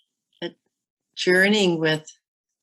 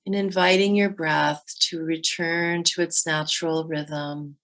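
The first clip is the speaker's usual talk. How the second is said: guided meditation. Acted. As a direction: guide them gently through the breath.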